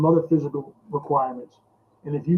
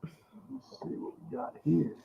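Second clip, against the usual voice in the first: muttering to himself; 2 semitones higher, 11 dB quieter, slower than usual. Real, not acted. Checking mic, muttering to self.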